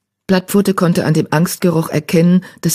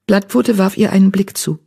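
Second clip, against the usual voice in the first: usual speaking voice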